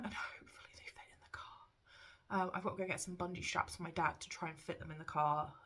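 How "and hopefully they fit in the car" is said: whispered hope. Real, not acted. Hoping the shelves fit.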